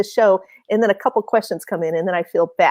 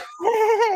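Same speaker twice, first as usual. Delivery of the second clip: high burst of laughter; 11 semitones higher, 4 dB quieter than usual. Real, not acted. Embarrassed reaction in story.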